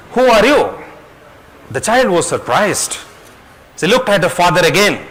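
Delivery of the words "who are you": high, loud echoing question; imitated echo of a child's shout; echoed call. A narrator's reading. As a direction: throw the question back loudly.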